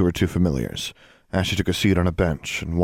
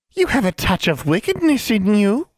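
put-on high, cackly old witch voice; old hag voice; 14 semitones higher, 4 dB louder than usual. Old woman tempts Alexandra at the station, high hag voice. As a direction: hag leaning in, sizing up a girl. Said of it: "the old woman said".

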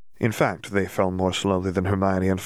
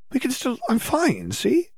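put-on dreamy girl. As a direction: brush off worry in a floaty haze. Dazed Hermione.

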